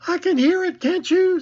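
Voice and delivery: nervous little pig asking for reassurance; anxious Piglet. A narrator's reading. Piglet, anxious, as they near the trap, very high. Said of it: "said Piglet anxiously".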